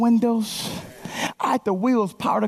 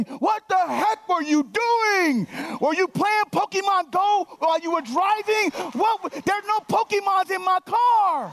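higher, hammed-up angry yelling at the other driver; mock crazy rant; 9 semitones higher than usual. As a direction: play the overreacting driver, shrill with outrage. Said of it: "what if I had gotten all crazy?".

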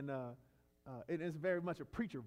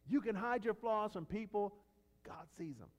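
ordinary talk, nothing marked

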